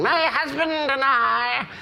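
high, slow, mock royal English voice; the Queen, mocked English accent. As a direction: imitate a very strange, high-pitched regal English accent. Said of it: "this voice from Her Majesty the Queen".

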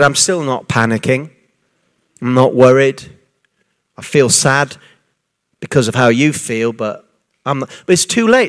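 steady and tender, no panic in it; calm, gentle. Retold or imitated. Jesus answering calmly.

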